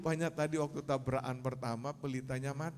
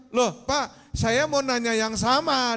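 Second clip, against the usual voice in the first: high, raised exclamation; surprised retort; 7 semitones higher, 6 dB louder, slower than usual. Acted. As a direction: exclaim at the coincidence, pitched up. Second man exclaims in surprise. (kaget, menyahut dengan nada tinggi)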